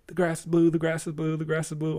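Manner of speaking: high, gleeful chant; happy sing-song. Retold or imitated. Donkey running away, chanting high and happy. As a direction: chant it happily while running off. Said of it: "all happy".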